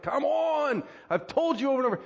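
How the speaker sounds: mock parental nagging, pitched right up; frustrated parent. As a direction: plead like a parent at wit's end. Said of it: "we get frustrated".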